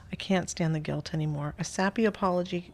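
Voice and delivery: ordinary talk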